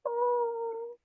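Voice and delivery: high, cooing hello to a cute animal; real high-pitched coo. Real, not acted.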